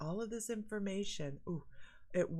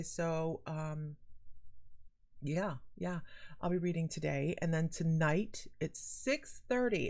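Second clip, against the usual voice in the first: usual speaking voice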